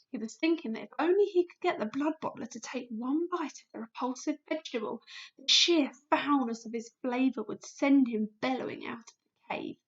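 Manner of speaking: ordinary delivery, nothing marked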